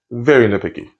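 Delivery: ordinary talk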